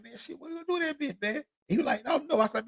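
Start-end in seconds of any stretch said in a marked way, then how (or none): 0.0-1.4 higher, softer, fast quip; quoted question to friend. Retold or imitated. Asking his friend Doug what he'll do with it, quick high hushed question. 2.1-2.4 higher, small mock reply; friend's shrug. Friend Doug answering that he doesn't know, high unsure reply.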